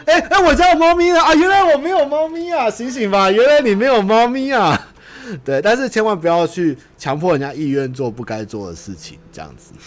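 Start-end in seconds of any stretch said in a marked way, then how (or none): 0.1-4.9 very high, loud mock shriek; shrieking hammy voice. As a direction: shriek like a cartoon character. Acted dialogue, mock realization.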